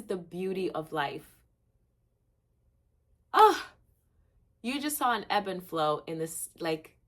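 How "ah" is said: high, loud happy cry; real joyful squeal. Real, not acted. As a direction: let out a thrilled shriek. Elated outburst.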